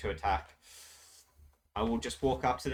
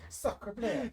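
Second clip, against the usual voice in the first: put-on French accent; 11 semitones higher than usual. Exclaims in mock French.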